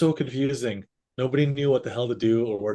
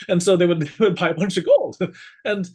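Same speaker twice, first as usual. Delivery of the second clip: laughing; 6 semitones higher, 6 dB louder than usual. Real, not acted. Laughing at own scam story.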